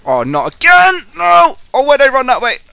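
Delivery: loud, high cry of frustration; frustrated yell. Real, not acted. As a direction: yell as it all goes wrong again. Caught again while sneaking.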